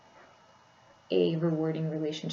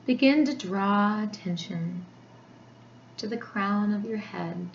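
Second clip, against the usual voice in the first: guided meditation; 3 semitones higher than usual. Acted. Therapist guiding listeners into a body scan, meditation voice.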